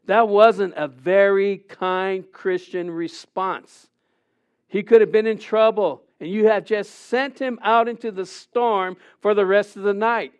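usual speaking voice

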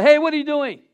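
high, loud startling question; imitating wife. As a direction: impersonate wife sneaking up. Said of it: "Carmen comes up and says".

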